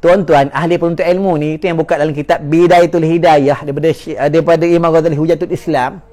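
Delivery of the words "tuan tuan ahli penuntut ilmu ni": ordinary delivery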